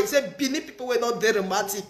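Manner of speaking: ordinary talk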